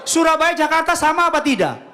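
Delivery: high, loud call to the crowd; rally the congregation by shouting. Real, not acted. Preacher calling out to Surabaya and Jakarta, loud.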